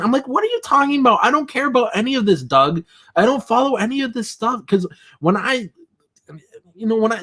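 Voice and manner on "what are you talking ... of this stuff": exasperated. Retold or imitated. Exasperated reply to Doug retold.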